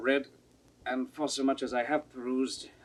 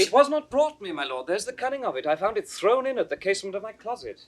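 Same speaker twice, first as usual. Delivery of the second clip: agitated protest; 10 semitones higher, 8 dB louder, faster than usual. Acted. Edmund feigns innocence to father.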